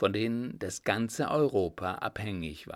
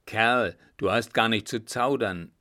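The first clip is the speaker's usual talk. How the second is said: louder rebuke of the coachman; angry call; 6 dB louder than usual. Acted. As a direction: snap at him to stop dawdling. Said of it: "rief derjenige".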